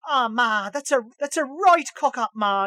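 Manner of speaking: exaggerated Irish, pitched well up; fake Irish accent. Host imitating a computer game's main character, fake Irish accent. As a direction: imitate a badly faked Irish game hero. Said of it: "this Irish guy that's clearly not Irish".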